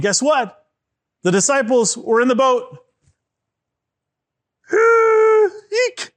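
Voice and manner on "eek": very high mock shriek; frightened squeal. Storyteller acts the disciples in the stormy boat, frightened squeal.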